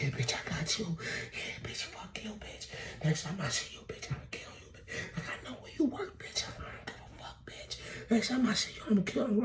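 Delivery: whispery, winded mock threats; imitating out of breath. Worn-out fighters threatening each other, mock breathlessness. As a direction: gasp out insults with no air left. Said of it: "They just out of breath now".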